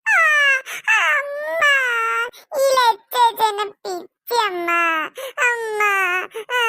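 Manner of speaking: put-on boy wailing for his mother, slowly; crying child. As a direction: sob out the complaint to mom. Boy cries after being pinched.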